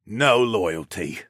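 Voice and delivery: slow, grunted scorn; drawn-out contemptuous grumble; grunting. A narrator's reading. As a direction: grunt it out in disgust. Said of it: "Luca grunted".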